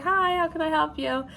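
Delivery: lightly put-on helpful manager greeting; customer-service greeting. Manager greeting a customer out front, lightly put-on service voice. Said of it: "So I go out front".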